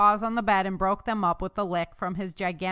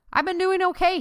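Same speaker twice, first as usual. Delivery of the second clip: admitting; 11 semitones higher, 7 dB louder than usual. Acted. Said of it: "he admitted".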